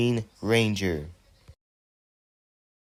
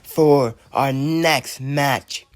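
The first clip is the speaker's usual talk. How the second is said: big ring announcement; 2 semitones higher, 4 dB louder, slower than usual. Acted. Announcer opening the next match to the crowd, booming and slow. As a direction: boom it out to the arena.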